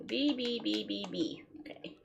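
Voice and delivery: ordinary talk, nothing marked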